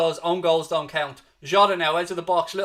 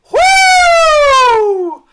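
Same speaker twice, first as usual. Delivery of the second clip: whoop; 18 dB louder than usual. Real, not acted. Goal chance cheer in watchalong.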